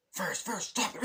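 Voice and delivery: high-pitched, urgent imitation; frantic warning squeal, acted out; mock panic. Friend warns Ferris the dad's there, mock panicky. Character alarmed on spotting dad.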